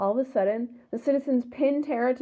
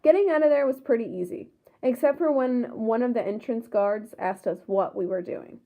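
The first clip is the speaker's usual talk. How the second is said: ordinary delivery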